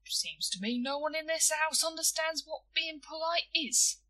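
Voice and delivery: high-pitched aggrieved complaint; injured, bitter grumble held up high. A narrator's reading. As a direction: complain as the one misunderstood. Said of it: "said William bitterly".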